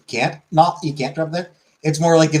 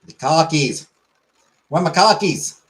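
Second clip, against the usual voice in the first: higher-pitched Boston accent, no R's; 4 semitones higher than usual. Shown as a demonstration. Streamer practising car keys without R's, Boston accent. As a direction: ask it like a Bostonian. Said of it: "We don't do our R's".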